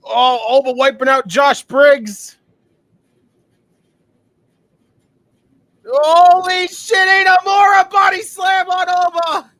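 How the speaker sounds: stretched, screaming holy shit at a body slam; hyped shout. Real, not acted. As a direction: yell it like the best spot of the night. Hype reaction to a wrestling slam.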